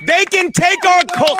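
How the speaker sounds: rally the troops over a coffee; a Braveheart-style cry about cold brew; mock heroic rally cry. Mock heroic speech on subway.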